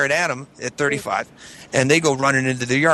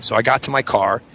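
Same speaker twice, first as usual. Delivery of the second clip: normal talking voice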